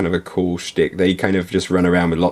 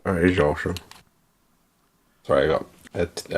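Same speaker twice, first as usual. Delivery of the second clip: talking around a toothbrush; words muffled by a brushing mouth; 5 dB quieter, faster than usual. Real, not acted. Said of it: "I got a brush in my mouth".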